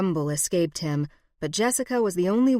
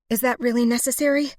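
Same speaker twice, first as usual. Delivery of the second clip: higher, louder, fast protest; 7 semitones higher, 5 dB louder, faster than usual. A narrator's reading. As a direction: push back sharply, rattled. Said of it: "she protested".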